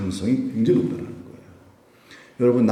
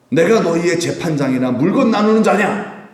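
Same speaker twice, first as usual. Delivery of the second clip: higher, forceful rhetorical challenge; indignant rebuke; 5 semitones higher, 4 dB louder than usual. Retold or imitated. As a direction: demand it angrily, voice climbing. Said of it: "예수님도 화가 나시잖아요". (화가 나서 되묻듯 호통치는 말투)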